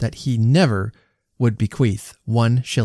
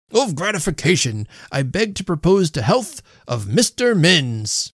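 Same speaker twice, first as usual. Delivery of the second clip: toast proposal; 6 semitones higher than usual. A narrator's reading. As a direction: propose the health grandly. Host concludes the toast.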